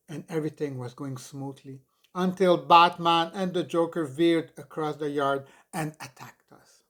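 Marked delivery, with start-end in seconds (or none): none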